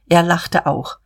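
usual speaking voice

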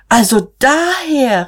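high, drawn-out exclamation; sudden realisation, voice soaring; crying out. A narrator's reading. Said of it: "rief Magda".

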